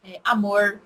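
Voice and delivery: put-on interior Goiás accent with retroflex R in "porta"; Goiás countryside accent. Teacher imitating her professor from Goiás, countryside accent. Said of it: "ela é do interior de Goiás".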